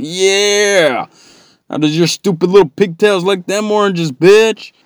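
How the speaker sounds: high, loud gloating shout; taunting yell. Retold or imitated. Gambler taunting the spelling bee rival, high and loud. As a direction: taunt the girl like a rowdy fan. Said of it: "started taunting his opponent".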